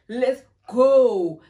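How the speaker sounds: loud, drawn-out cheer; rallying shout. Retold or imitated. Friend retelling the start of the night, rallying shout. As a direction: hold the words long and loud. Excited call to get going.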